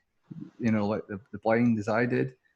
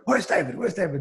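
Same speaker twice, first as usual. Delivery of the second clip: higher-pitched imitation of someone searching; calling out for someone; 7 semitones higher than usual. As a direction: mimic a puzzled man looking around. Retold presenter hunting for David.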